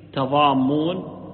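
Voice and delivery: slow تضامون, ta- and a long double m; a after t, doubled m. Shown as a demonstration. Teacher gives the second narration, slow تضامون with ta- and doubled m. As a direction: say تضامون as tadammun, drawn out. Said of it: "جاءت بفتح التاء وتشديد الميم".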